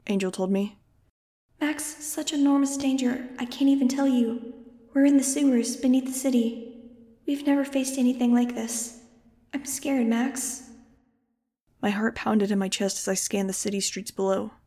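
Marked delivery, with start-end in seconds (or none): 1.6-10.7 put-on a small girl pleading from far away; scared child calling for help; frightened little girl. Angel's voice in Max's head.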